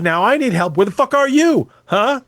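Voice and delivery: hammed-up outburst demanding where he is; imitating a yell. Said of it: "starts yelling at his".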